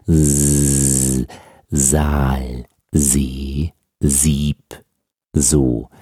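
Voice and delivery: low, drawn-out buzzing z onsets; voiced S demo. Shown as a demonstration. Teacher shows learners the vibrating larynx, voiced S. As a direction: sustain a voiced S, buzzing in the throat, low and level. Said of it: "dann spürt man da etwas vibrieren".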